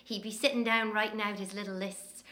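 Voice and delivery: ordinary delivery, nothing marked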